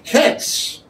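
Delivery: Ketz breathed out, short and unvoiced; Hebrew demo. Shown as a demonstration. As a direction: whisper the word Ketz. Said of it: "as in terminal end".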